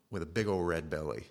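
ordinary talk, nothing marked

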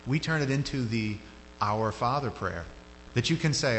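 normal talking voice

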